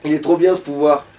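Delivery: normal talking voice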